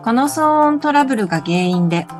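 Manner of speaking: normal talking voice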